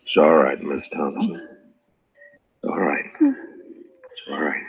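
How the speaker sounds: calm her down gently; soothing. Acted. Soothing the injured woman.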